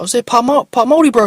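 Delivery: high, loud and pushy; loud street stranger. Retold or imitated. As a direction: fire the question up high and loud. Stranger asks his heritage.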